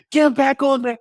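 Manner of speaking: high, strained call to come back; hammed-up pleading shout, on the edge of laughter; mock urging. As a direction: holler it high, half laughing. Playfully urging his kids back.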